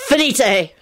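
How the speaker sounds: mild, high shout; shouted spell. A narrator's reading. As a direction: call out the spell urgently. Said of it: "when Lupin shouted".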